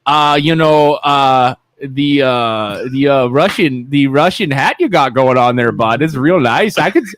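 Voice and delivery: a lightly voiced Canadian ribbing his friend; Canadian accent. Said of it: "can you do the Canadian accent".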